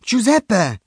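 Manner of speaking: high, loud call; boy calling the fish. A narrator's reading. Luca calls his curious goatfish, high voice.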